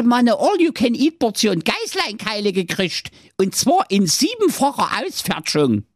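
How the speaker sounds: sehr hohe, piepsige Zicklein-Stimme, leicht japsend; hoch und quäkig wie ein junges Zicklein; kleinstes Geißlein. Acted. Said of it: "sprach das Geißlein". (hohes Geißlein-Gepiepse nach der Drehtür)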